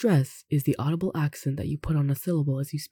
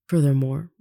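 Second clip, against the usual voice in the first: normal talking voice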